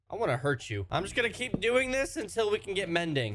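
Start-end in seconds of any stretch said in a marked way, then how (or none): none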